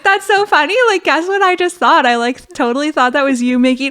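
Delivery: high, loud bubbly amusement; cheerful and oblivious; chirpy chatter. Retold or imitated. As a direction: gush about the funny mix-up. Chatting happily, not grasping urgency.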